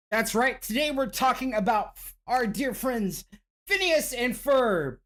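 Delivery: upbeat show-opening host read; podcast host intro. Acted. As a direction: pitch the episode like a host.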